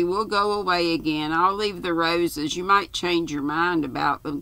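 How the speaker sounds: ordinary delivery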